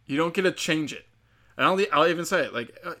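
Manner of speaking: ordinary delivery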